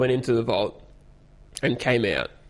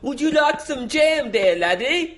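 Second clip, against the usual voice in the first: high, slow mock Scottish accent; 12 semitones higher, slower than usual. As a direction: play a Scotsman offering jam. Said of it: "more English than anything".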